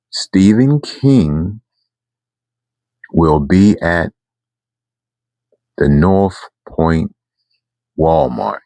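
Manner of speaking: slow, spelled-out news, each word weighed; slow big announcement. Retold or imitated. The person breaking news to the fan, slowly. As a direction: lay it out slowly so it sinks in.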